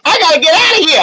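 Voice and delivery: high, hurried exclamation. Acted. GM voicing [name] as she bolts for the crowd. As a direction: blurt it while turning to flee. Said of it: "She's like".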